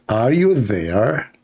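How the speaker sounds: very slow, stretched mock British accent; attempted English accent. Shown as a demonstration. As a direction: draw it out in a bad English accent. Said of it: "let me speak in the English accent".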